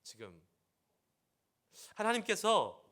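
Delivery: usual speaking voice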